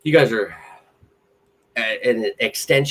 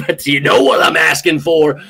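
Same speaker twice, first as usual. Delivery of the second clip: hype shout; 3 semitones higher, 6 dB louder than usual. Real, not acted. Hyping the audience.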